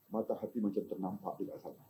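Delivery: ordinary talk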